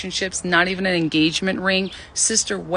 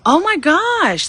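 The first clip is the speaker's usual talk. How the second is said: delighted surprise; 11 semitones higher, 5 dB louder, much slower than usual. Real, not acted. Reading a nice comment.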